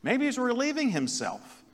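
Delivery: high, fast sarcastic jeer; mocking taunt. Elijah taunting the prophets of Baal, high mocking jeer. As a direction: needle them like a heckler. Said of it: "He's mocking them all the time".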